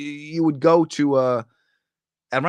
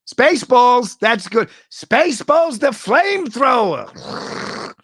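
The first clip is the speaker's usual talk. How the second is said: much higher, louder hammed-up movie voice; excited movie impression, higher and louder; movie quote; 13 semitones higher, 9 dB louder, much slower than usual. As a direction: belt it like the film character. Quoting Spaceballs flamethrower scene.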